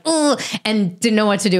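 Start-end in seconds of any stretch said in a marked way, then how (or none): 0.1-0.4 retold groan. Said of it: "I was like".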